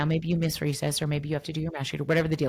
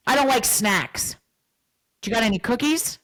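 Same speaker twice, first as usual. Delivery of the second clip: put-on grumpy kid voice; 5 semitones higher, 4 dB louder than usual. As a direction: play the pouting kid. Child's reply voiced by therapist.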